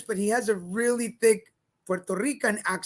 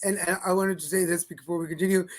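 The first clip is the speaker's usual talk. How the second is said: normal talking voice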